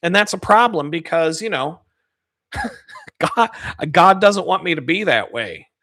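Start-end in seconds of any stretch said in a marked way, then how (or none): 2.5-3.5 high squeaky laugh; rueful laugh. Real, not acted. Laughing at his own anger before mentioning God, rueful. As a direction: laugh it off at yourself. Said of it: "laughs at himself".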